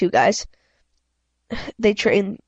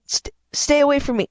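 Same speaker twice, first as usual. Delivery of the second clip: higher, stammering plea; scared stammer; 5 semitones higher than usual. A narrator's reading. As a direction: back away in fear. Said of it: "I shouted".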